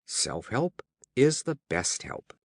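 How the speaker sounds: ordinary delivery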